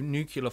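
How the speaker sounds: nuclear said as NOO-kyuh-luh; nuclear slipped into three syllables, "new-cue-luh"; mispronounced nuclear. Real, not acted. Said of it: "I always say that wrong".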